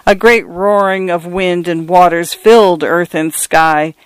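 plain reading voice

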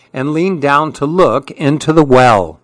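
plain reading voice

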